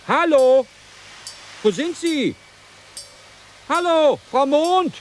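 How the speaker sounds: Hallo, loudly called out to someone missing; calling out. Acted. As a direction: shout into the dark for her. Captain searching for missing woman.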